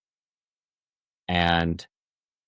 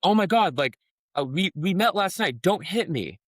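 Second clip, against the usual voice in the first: panicky pleading; 9 semitones higher than usual. Retold or imitated. Man in the car pleading with the speaker not to hit him, panicky and high. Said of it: "but he said".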